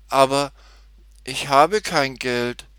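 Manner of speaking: a touch of helpless upset, drawn out; dismayed, helpless. A narrator's reading. Tutti admits she cannot pay, mildly dismayed. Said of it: "erklärte sie betrübt und ratlos".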